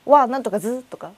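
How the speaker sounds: rough mock Tsugaru dialect; Shanghainese-like Tsugaru. Shown as a demonstration. Showing the host Shanghainese as Tsugaru, in mock dialect. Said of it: "上海語は津軽。".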